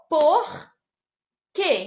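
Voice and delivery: "Por", loud with a strong final R, pause, then "quê"; syllable by syllable. Shown as a demonstration. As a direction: break "Por quê" in two, leaning on the R. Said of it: "o R é a última letra da sílaba".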